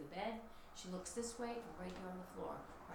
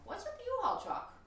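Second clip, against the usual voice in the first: surprised; 7 dB louder than usual. Retold or imitated. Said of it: "and I'm like".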